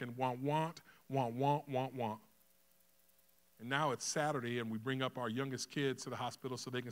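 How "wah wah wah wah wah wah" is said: loud wordless wah-wah imitation; mock droning talk. Father no longer taking in the hospital plan, mock droning. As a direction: blare wah-wah over the doctors' words. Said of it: "all I hear is".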